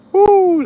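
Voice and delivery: loud, high-pitched "woo"; high whoop. Real, not acted. Exclaims on breezy shaded path.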